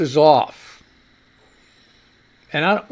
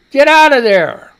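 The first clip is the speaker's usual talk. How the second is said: louder, higher yell at the cat; 6 semitones higher, 8 dB louder than usual. Real, not acted. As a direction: shout to chase the cat off. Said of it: "Sorry, I'm yelling".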